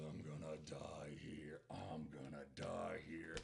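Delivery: low, slow, very soft groan of a voice; weakly drawn-out last words; dying man. Acted. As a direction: act out a man fading away. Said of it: "he's lying there".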